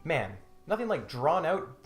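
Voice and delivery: ordinary talk, nothing marked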